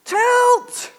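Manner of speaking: high, loud retold yell. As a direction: bark the order up high. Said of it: "he yells".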